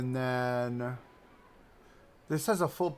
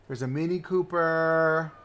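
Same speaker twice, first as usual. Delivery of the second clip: slow, stretched-out announcement; drawn-out delight; 3 semitones higher, 4 dB louder than usual. Real, not acted. Streamer points out a favorite car again, drawn-out delight. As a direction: draw out the last word with delight.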